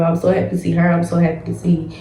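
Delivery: normal talking voice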